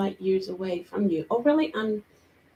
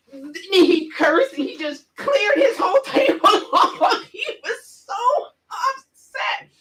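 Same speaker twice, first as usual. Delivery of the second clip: high, words breaking into laughs; laughing while telling; 9 semitones higher, 3 dB louder than usual. Real, not acted. Scene: describing the angry student, high and giggling. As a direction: giggle through the story.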